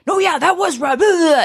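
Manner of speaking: high, hyped agreeing friend; amped-up validation. Retold or imitated. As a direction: imitate a friend eagerly egging you on. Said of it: "that kinda amps you up".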